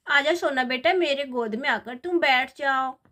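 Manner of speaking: ordinary delivery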